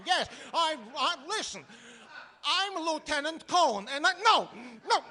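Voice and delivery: lightly voiced flustered caller with Yiddish-tinged accent; comic immigrant on the phone. Tenant calls the bank about his shutter, growing exasperated. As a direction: play the harried tenant shouting into the phone. Performs the Cohen telephone sketch.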